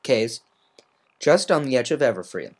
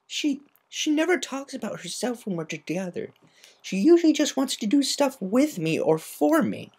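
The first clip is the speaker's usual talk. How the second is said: higher, subdued pegasus; a tomboy admitting she didn't know; Rainbow Dash voice; 13 semitones higher than usual. Acted.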